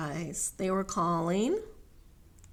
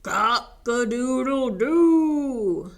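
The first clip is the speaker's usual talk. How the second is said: loud, slow, full crow; crowing rooster; 5 semitones higher, 9 dB louder, slower than usual. Acted. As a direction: crow proudly with a long last note. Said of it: "finished Rooster".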